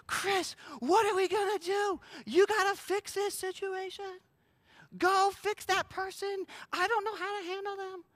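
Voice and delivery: very high, whiny-pitched fake panic; mock freak-out. Jesus imagined begging us for help, mock panic. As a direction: plead helplessly in a hammed-up high voice. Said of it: "Jesus is freaking out".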